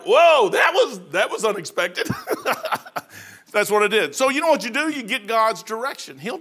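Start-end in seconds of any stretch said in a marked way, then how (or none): 0.1-2.1 laughing in real surprise, higher and louder. Real, not acted. Reacts to pastor's outburst. 2.1-3.3 airy, unvoiced high laugh; high breathless laugh. Real, not acted. Laughs after retelling outburst.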